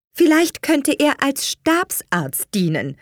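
high, slow mediating mother; conciliatory. A narrator's reading. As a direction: offer a compromise in a lifted voice. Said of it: "warf Missy Hill vermittelnd ein". (vermittelnd, hoch und langsam)

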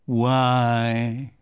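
slow, mournful sigh of a question; sad Eeyore. A narrator's reading. Eeyore brooding sadly to himself, mournful. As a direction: let it sink like a gloomy donkey. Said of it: "he thought sadly".

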